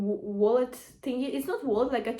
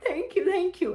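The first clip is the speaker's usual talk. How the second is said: grateful thanks; 8 semitones higher than usual. Retold or imitated. Let through the line by a family.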